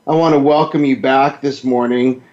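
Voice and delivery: ordinary talk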